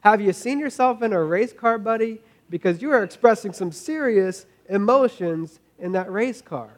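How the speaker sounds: high, loud teasing challenge to the driver; loud mock disbelief at a racer who denies being emotional; loud mock retort. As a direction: call him out, loud and incredulous. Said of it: "I'm like".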